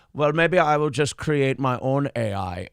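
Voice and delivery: slow, measured German-accented imitation; mock German accent, deliberately slowed. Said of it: "you give him a German accent".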